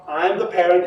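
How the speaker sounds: usual speaking voice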